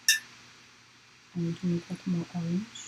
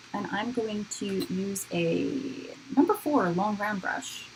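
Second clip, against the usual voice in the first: usual speaking voice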